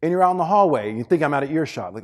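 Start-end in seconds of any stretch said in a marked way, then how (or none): none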